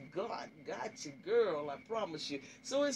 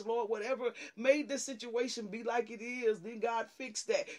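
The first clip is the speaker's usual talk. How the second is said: high, urgent pleading prayer building louder; fervent intercessory prayer; 5 semitones higher, 4 dB louder than usual. Acted. Pleading with God to heal their heart, fervent prayer. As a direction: pray it out in a rising, driven stream.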